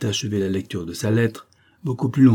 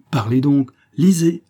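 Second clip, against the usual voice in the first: urgent; 6 semitones higher, 6 dB louder than usual. A narrator's reading. Prince urges trembling countess.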